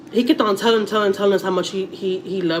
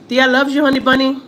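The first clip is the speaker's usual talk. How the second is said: affectionate coaxing; 6 semitones higher than usual. Real, not acted. Talking to nephew on call.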